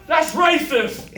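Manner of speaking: mock offended shout, slow; loud, slow, mock-indignant protest; indignant heckler. Heckler's complaint acted out.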